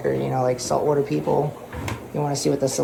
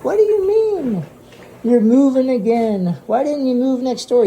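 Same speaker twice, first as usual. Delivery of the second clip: much higher, louder mock-exasperated friend; teasing shop owner; 10 semitones higher, 6 dB louder than usual. Shop owner harasses host about moving, high teasing voice. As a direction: play the friend nagging about the house. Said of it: "He's like".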